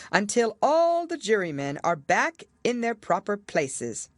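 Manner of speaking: grave king. A narrator's reading. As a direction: weigh each word like a solemn king. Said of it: "in a very grave voice".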